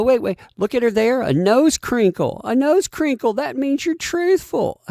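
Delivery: high, loud gushing mock analyst voice; mocking media analysts. Mocking the body language expert to his viewers, high and gushing. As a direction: gush over every tiny gesture in a silly high voice. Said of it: "they're saying".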